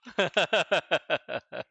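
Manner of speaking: bursting, high-pitched giggle; high laugh. Real, not acted. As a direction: crack up loudly at the email. Laughs after replying hello.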